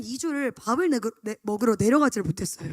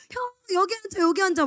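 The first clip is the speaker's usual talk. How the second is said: lightly voiced child, higher pitch; imitating a little kid; 7 semitones higher than usual. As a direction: coax him like an eager little boy. Kids begging the older brother.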